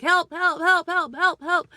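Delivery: loud high cries of help; calling for help. Retold or imitated. A mother calling out for help, loud and high. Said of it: "I was like".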